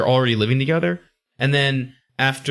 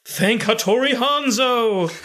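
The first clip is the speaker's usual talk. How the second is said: high, drawn-out mock-heroic cry; hammed-up cry of thanks, laughter underneath; Ninja's exclamation; 9 semitones higher, slower than usual. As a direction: proclaim it slowly with comic fervour. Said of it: "really hilarious exclamation from Ninja".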